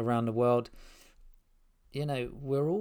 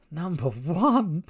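drawn-out, higher, louder disbelief; amazed repeat; 6 semitones higher, 6 dB louder, much slower than usual. Real, not acted. Host amazed at his show's global reach, incredulous. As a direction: say it slowly, voice swooping up in amazement.

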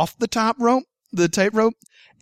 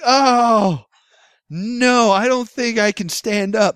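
retold protest; 5 semitones higher, 6 dB louder, slower than usual. Retold protest to would-be rescuer.

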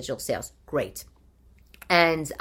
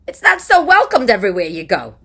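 much louder, higher blurt; raised-voice complaint about not being welcomed; loud outburst; 4 semitones higher, 10 dB louder than usual. Real, not acted. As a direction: burst out in loud protest. Outburst about the accent being unwelcome.

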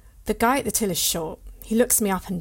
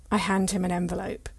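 plain reading voice

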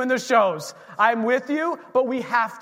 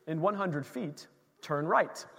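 softer, even, mock navigation announcement; sat nav voice; 5 semitones lower, 7 dB quieter than usual. Pastor imitates the car's navigation voice, mildly. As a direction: read it like the phone's calm directions. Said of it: "the volume comes down and says".

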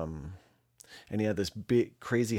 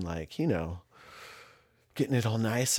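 normal talking voice

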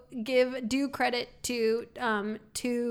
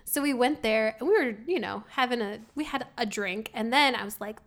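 ordinary talk

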